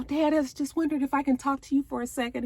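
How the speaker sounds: put-on high, fast little-girl voice; a sweet high daughter asking fast. Daughter asking her dad for a word, high and quick. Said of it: "the daughter comes out".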